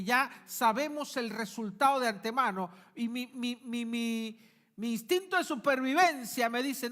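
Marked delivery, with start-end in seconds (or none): none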